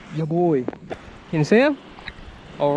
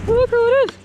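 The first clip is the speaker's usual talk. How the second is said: high and loud, delighted; thrilled discovery; excited; 6 dB louder, faster than usual. Real, not acted. Finds drone on camera.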